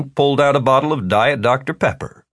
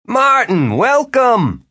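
welcoming host voice; 13 semitones higher, 4 dB louder, much slower than usual. A narrator's reading. Jimmy greets warmly, voiced high.

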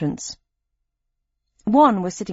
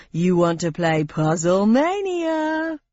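slow, showy quiz host pitch; game show host voice; slower than usual. Acted.

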